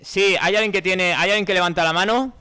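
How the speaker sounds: loud, high-pitched appeal to the audience; calling out. Real, not acted. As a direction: call across the room for volunteers. Host calls for a raised hand.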